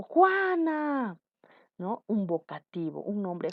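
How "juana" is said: high, drawn-out call of a name; calling someone. Shown as a demonstration. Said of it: "cuando te llaman". (llamado agudo y alargado)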